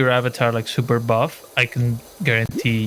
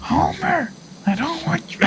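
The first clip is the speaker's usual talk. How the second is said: very high raspy Marge voice; Marge Simpson voice; slower than usual. Retold or imitated. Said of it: "[name] can do Marge".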